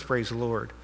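normal talking voice

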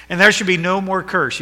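ringing pulpit proclamation; preaching at full force. Acted. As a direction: declare it to the whole church. Reads verse with force.

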